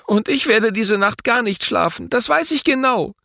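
put-on high child voice, defiant; stubborn boy. A narrator's reading. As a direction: insist like a sleepless little boy.

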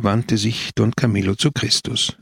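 ordinary delivery

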